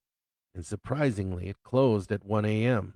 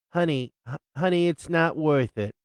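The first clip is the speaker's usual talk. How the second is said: higher pleading wife voice; wife talking her husband down, higher; wife calming him; 6 semitones higher, 4 dB louder than usual. Acted. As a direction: coax him out of the fight, pitched up.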